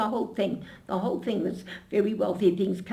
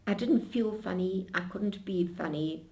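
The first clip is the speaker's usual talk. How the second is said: normal talking voice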